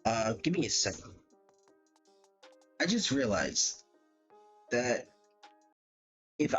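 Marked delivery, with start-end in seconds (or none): none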